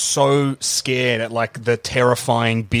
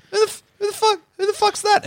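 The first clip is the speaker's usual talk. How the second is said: very high, startled outburst; shocked audience member; faster than usual. Retold or imitated. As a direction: shriek it in sudden alarm. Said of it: "you're like".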